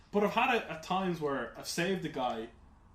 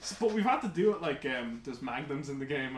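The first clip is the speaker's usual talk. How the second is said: ordinary talk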